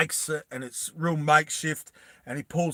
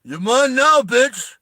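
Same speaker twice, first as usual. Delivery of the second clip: loud, high triumphant taunt; mock action hero yell; 8 semitones higher, 7 dB louder, slower than usual. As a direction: bark it like the hero yanking her in. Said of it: "that's where he goes".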